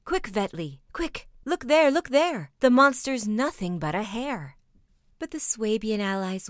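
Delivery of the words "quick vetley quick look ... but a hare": excited calls, growing louder; joyful cry. A narrator's reading. Schultz seeing the hare flee, joyful cry. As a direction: call your friend to look, overjoyed. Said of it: "he cried in his joy".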